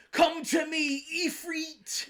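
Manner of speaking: high, loud, hammed-up British call; mock British summon. Mocking the voice actor's British summon line. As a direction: bellow the summon in an overdone British accent. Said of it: "the guy who goes".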